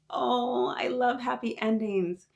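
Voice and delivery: high, gushing reaction; delighted. Real, not acted. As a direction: gush over the happy ending. Reader reacts to happy ending.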